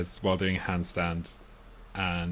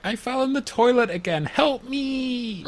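mock cry for help; 13 semitones higher than usual. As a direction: call out for help in imitation, pitched way up. Film character calling from bathroom.

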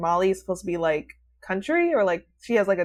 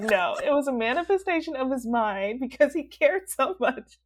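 insistent; 6 semitones higher than usual. Retold or imitated. Retold insistence to the friend.

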